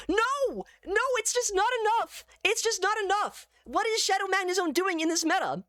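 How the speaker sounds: very high wail of dismay; exasperated squeal at a crushing letdown; anguished outcry. Real, not acted. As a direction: cry out in high-pitched disbelief. Dismay as attack falls short.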